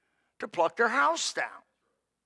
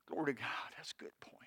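soft breathy whisper; whispered aside; 7 semitones lower, 17 dB quieter, faster than usual. Real, not acted. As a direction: whisper it to yourself.

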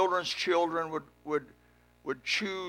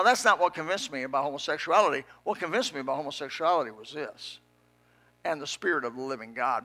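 usual speaking voice

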